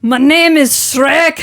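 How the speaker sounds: slow, loud Scottish ogre shout; Shrek impression. Cohosts goofing, a slow shouted Shrek voice. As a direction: boom it out like Shrek in his swamp. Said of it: "I can do it if I imitate Shrek".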